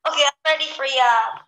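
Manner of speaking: ordinary delivery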